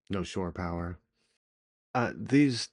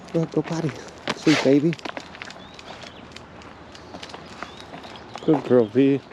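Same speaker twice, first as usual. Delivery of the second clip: high, loud sing-song dog praise; talking to a dog; 4 semitones higher, 4 dB louder than usual. Real, not acted. Praising her dog outside.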